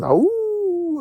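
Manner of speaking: high held howl; mock wolf howl. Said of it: "a wolf that goes".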